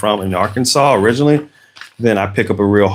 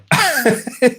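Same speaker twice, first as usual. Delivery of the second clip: high chuckle; short laugh after admitting difficulty; amused chuckle. Real, not acted. Chuckling about a hard Glaswegian accent, amused.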